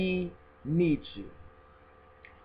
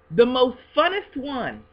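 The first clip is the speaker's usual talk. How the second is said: excited; 7 semitones higher, 7 dB louder, slower than usual. Real, not acted. Announcing the next present.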